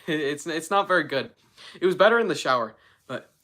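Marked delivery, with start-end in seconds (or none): none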